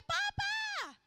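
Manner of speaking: put-on high, loud cry of a child calling his father; child's sudden shout. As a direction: yell out "Papa" like an amazed kid. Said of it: "bigla siyang sumigaw". (biglang malakas at mataas na sigaw ng bata)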